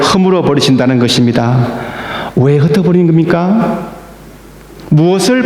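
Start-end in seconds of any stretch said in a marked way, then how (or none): none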